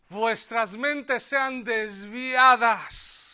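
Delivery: loud, high, slow sermon climax; preaching at full force. Acted. Preacher warning the congregation, loud and slow. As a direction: thunder each phrase out slowly.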